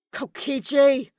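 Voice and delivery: loud slow angry growl of a name. A narrator's reading. Kaito growls at his captor, loud and slow. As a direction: drag the name out in fury. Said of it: "Kaito growled".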